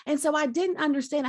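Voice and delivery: ordinary talk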